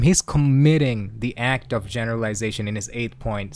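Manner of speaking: ordinary talk, nothing marked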